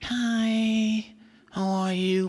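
high, drawn-out monotone greeting; boring girl's voice. Retold or imitated. Performer imitates his boring girlfriend onstage, high drawn-out monotone. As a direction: hold each word long on one high note. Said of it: "basically her voice was very like".

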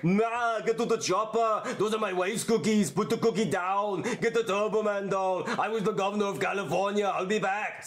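lightly voiced Austrian-accented action star; slow, heavy Austrian action-hero lines; a hint of an Austrian movie star growl; Arnold Schwarzenegger. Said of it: "you wanna be Arnold Schwarzenegger".

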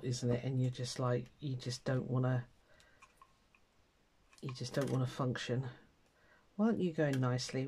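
normal talking voice

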